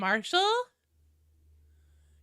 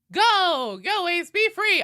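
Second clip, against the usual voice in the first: cheering send-off; 6 semitones higher, 6 dB louder, slower than usual. Pretend. Cheering a chatter off.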